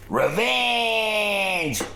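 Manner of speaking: high, long-held gloating cry; mock triumphant cry. Teller gloating after refusing the girl, hammed-up triumph. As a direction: stretch it out in hammed-up victory.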